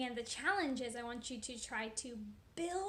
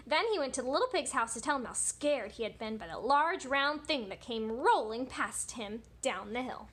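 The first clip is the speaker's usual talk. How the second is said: lively, louder read-aloud with big swoops; story read to children; 3 semitones higher, 8 dB louder than usual. A narrator's reading. Teacher reading the Three Little Pigs to children, animated storytelling. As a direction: read it animatedly, stretching key words.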